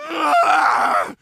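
loud agonized scream; loud cry of frustration; frustrated scream. Acted. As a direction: let out a raw yell of torment. Tormented patient screams.

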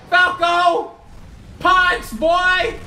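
high, loud attack-name shout; triumphant shouted special move; battle cry. Pretend. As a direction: yell the move in separate bursts.

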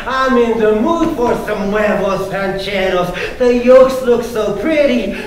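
playful spoken-word poem riding each rhyme; performance poetry. Acted. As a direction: bounce the rhymes to the crowd. Poet performing a rhyming poem.

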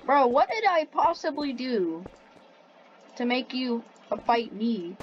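ordinary talk, nothing marked

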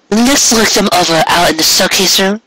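high, teasing mare voice, coy and flirty; high coy filly voice; playful mare voice. A narrator's reading. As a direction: bat your eyes through the words, very high. Cupcake's voiced lines.

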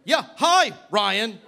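high, loud burst of hello; manic, on-edge shout; frantic greeting. Acted. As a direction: blurt the greeting far too loudly. Frantic greeting to new roommate.

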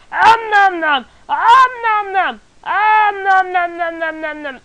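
loud high om nom chant; greedy smacking while eating. Pretend. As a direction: gobble it up noisily, slowly. Eating cactus pear, playful.

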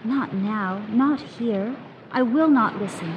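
ordinary delivery

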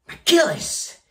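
high, sudden proud outburst; rallying cry. Acted. Boss hails the trained brokers as killers, rallying cry.

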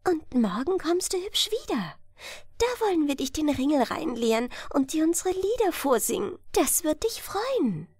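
put-on high, light fairy-child voice; high nixie children voice. Water-sprite children telling Ella to row home, high and light. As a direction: sing it out like playful water sprites. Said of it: "und sprachen zu ihr".

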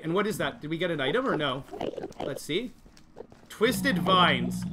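ordinary delivery

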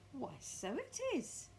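slow, high-pitched realisation; Mrs Twit surprised. Acted. Mrs Twit looking at her stick, slow high surprise.

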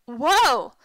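loud, impressed outburst; amused exclamation. A narrator's reading. Jake reacting from a safe distance to the lunge, loud amused exclamation. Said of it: "Jake laughed from a safe distance".

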